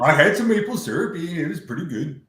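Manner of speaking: hammed-up small-town Canadian voice; mock Canadian. Friends joking about Canadians, put-on Canadian accent. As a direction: play a cheery Canadian caricature. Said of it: "that's pretty much Canada".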